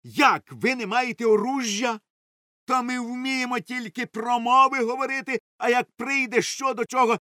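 high-pitched indignant outburst. A narrator's reading. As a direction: scold them in disbelief. Doctor indignant at lack of weapons.

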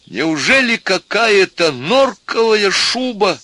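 high, slow, stretched plea; imploring appeal. A narrator's reading. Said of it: "продолжал профессор".

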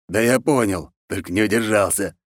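ordinary delivery, nothing marked

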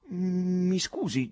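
slow, wary and suspicious. A narrator's reading. Chairman questions the stranger, slow suspicious tone. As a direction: draw it out, eyeing him with distrust. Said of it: "disse con sospetto".

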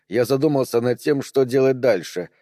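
ordinary delivery, nothing marked

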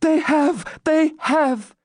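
very high, fervent confirmation; excited exclamation. A narrator's reading. Maiden confirms barriers are won, exclaiming. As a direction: cry the confirmation high and eagerly. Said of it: "exclaimed Rebecca".

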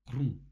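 громкий отрывистый «хрум», ниже по тону; изобрази хруст громко и коротко; хруст в кустах. A narrator's reading. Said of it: "Гораздо громче, чем прежде". (громкий низкий хрум)

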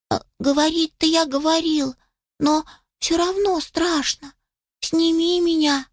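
high, whimpering kitten plea; plaintive kitten. A narrator's reading. Scared kitten admits it to puppy, plaintively. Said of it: "жалобно запищал котёнок".

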